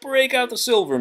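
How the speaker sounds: high, loud, lively; rally the kitchen; cheery order. Acted. Mrs Potts rallying the dishes for Belle. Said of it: "Missus Potts said".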